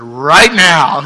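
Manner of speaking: Jesus could have said it, loud and eager; emphatic call. Shown as a demonstration. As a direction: snap it out with excitement. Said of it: "He could have said".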